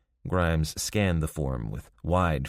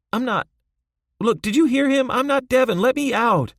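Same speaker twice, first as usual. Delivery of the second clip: much higher and louder, pleading yell; upset prisoner yelling his innocence, loud; upset protest; 8 dB louder than usual. A narrator's reading. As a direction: shout desperately, insisting.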